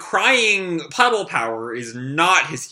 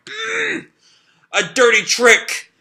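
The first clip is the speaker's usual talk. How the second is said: high whimper, then a higher indignant cry; strained protest; 9 semitones higher, slower than usual. Acted. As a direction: hold back tears and protest. Heroine fighting tears, protesting.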